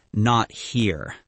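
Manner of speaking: usual speaking voice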